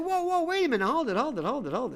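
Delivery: sharp high startled interruption; whoa hold it. Retold or imitated. Stopping the caller, a high startled whoa. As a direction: cut them off, high and alarmed.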